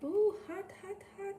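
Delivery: high yelp around a hot bite; gasping through a hot mouthful; mouth full of hot food. Real, not acted. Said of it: "Okay, let's blow".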